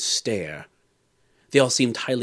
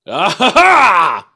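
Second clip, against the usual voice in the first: big, loud, high theatrical laugh; roaring laugh; 9 semitones higher, 13 dB louder, much slower than usual. A narrator's reading. King laughing at the drinking dwarf, roaring. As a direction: roar it out slowly as a gloating king. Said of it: "roared the latter".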